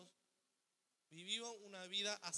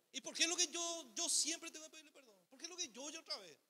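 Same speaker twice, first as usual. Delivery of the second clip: higher, mock-whining protest; whiny complaint; 7 semitones higher, 4 dB louder than usual. Pastor mimics a believer complaining he must always apologise, whiny. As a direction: whine like a put-upon believer asking why always me.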